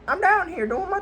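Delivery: very high squeaky cartoon voice; squeaky high Michael voice. Kid voicing Michael finding the secret room, squeaky. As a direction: jump way up into a squeak.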